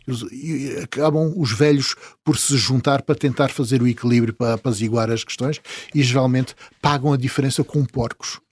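ordinary delivery

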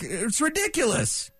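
usual speaking voice

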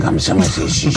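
lightly mocked tracheotomy voice; imitated tracheotomy whisper. Shown as a demonstration. Joking about whispering with a tracheotomy, imitated voice. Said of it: "si on chuchote avec une trachéo".

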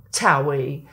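ordinary talk, nothing marked

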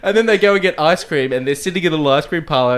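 usual speaking voice

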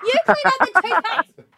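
high, laughing outrage at him; laughing disbelief. Real, not acted. Scene: host laughing in disbelief that he leaves cleanup to his wife. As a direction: laugh it out in mock horror.

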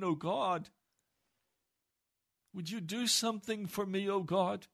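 high, loud, pleading preacher's cry; impassioned sermon climax; fervent preaching. Acted. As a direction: build the plea to full force. Preaching David's plea at full force.

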